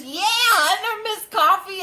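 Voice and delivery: high-pitched, eager imitation; excited fan. Woman saying she knows Miss Coffee, excited high imitation.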